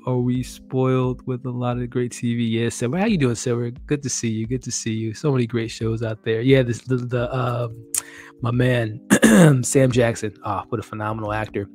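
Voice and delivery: ordinary talk, nothing marked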